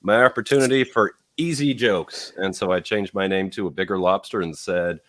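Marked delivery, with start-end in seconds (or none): 0.0-2.3 excited. Retold or imitated. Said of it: "And I said".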